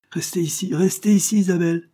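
high, urgent calling out; sudden high-pitched exclamation, building in force; spontaneous cry. A narrator's reading. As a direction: call after the child, pitched right up. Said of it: "s'écria spontanément l'institutrice".

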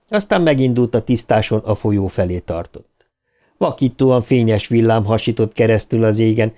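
plain reading voice

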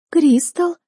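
high, sniffling cry of the name; snotty crying. Acted. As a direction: sob the name out through a runny nose. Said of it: "шмыгнула носом она".

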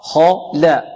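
خا thick and full, لا thin; heavy kha, light lam. Shown as a demonstration. Teacher drills each letter, heavy then light. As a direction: contrast a dark خا with a light لا. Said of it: "الخاء مفخمة واللام مرققة".

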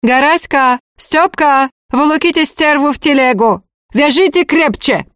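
high, curt calling-out; staccato high-pitched commands; abrupt summons. A narrator's reading. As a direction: order the servants in short bursts. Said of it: "отрывисто позвала".

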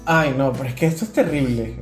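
ordinary talk, nothing marked

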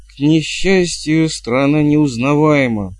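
slow, drawn-out stage declamation; declaiming. A narrator's reading. Actor recites his line in the play, declaiming. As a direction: declaim it grandly, stretching the words. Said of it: "продекламировал Притчет".

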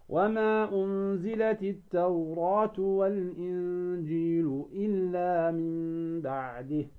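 slow chanted tajwid recitation; Quran recitation. Shown as a demonstration. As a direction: recite it slowly, like reading from the mushaf. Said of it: "مثلًا قوله تعالى".

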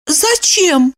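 high, thrown little question; bewildered. A narrator's reading. Woman told to turn away, bewildered. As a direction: ask it at a loss, pitch up. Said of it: "Она растерялась".

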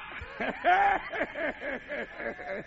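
risa contenida que va bajando; ríete entre dientes, alargándolo. Real, not acted. El predicador se ríe solo. (risita larga y decreciente)

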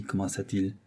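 ordinary delivery, nothing marked

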